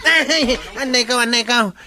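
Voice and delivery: high, loud cries of ouch, half laughing; pained cry. Acted. Chief yelping in pain while being beaten up, loud and high. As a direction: yelp as if hit and hurting.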